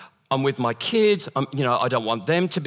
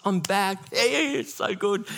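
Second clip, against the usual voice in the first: mock celebration; 7 semitones higher, slower than usual. Pastor celebrates months without panic, mock celebration. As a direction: squeal a little victory cheer, stretched out.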